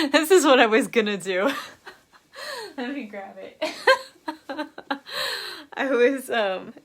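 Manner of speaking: giggling through the words; laughing. Real, not acted. Showing viewers her pillow trick, laughing. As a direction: laugh as you reach for the prop.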